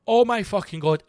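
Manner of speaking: retold frightened shout. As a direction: blurt it out like you've had a fright. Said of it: "He shouted".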